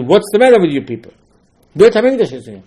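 angry rebuke. Retold or imitated. Nehemiah rebuking Shabbat breakers.

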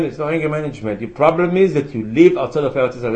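usual speaking voice

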